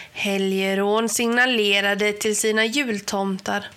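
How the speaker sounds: plain reading voice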